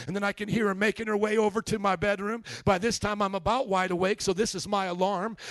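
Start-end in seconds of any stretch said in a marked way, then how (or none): none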